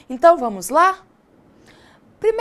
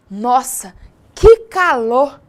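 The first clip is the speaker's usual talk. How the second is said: slow, very loud "que calor!"; exclamation; 3 semitones lower, 9 dB louder, much slower than usual. Shown as a demonstration. Teacher exclaims loudly to show exclamation intonation. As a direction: blurt it out as if sweltering. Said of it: "Viram só a entonação?".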